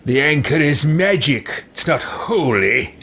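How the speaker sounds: slow, theatrical character voice; firm, drawn-out dramatic correction; emphatic game character. Acted. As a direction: declare it in character, drawing out the words. Voices his game character's reply.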